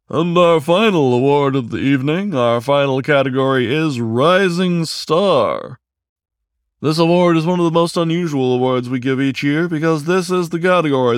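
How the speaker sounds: high, loud award-show emcee; announcer voice. Acted. Aardvark host announcing award.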